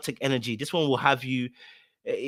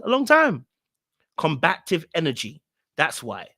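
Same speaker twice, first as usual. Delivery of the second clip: normal talking voice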